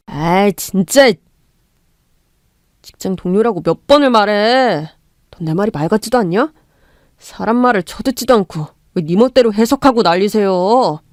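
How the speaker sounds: lightly voiced irritated husband; husband snaps back at his wife, lightly voiced. Voicing the husband.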